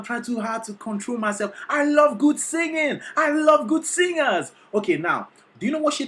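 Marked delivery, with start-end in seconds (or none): 1.7-3.0 excited. Real, not acted. Gushing reaction to singing. 3.2-4.5 excited. Real, not acted. Gushing reaction to singing.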